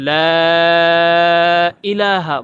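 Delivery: লা stretched long, then ইলাহা; drawn-out লা; long laa. Shown as a demonstration. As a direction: hold the লা for three alifs. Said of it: "সঠিক উচ্চারণ লাকে তিন আলিফ লম্বা করতে হবে".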